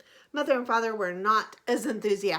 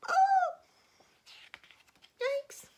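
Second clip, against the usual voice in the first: mock alarm; 4 dB louder than usual. Teacher reacting to danger.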